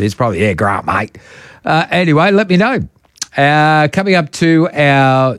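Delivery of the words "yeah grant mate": softer, laid-back Aussie Grant, mate; a hint of a broad Australian; mock Aussie. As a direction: lightly imitate a broad Aussie. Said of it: "if you're an Aussie".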